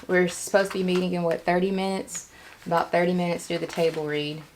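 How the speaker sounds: ordinary talk, nothing marked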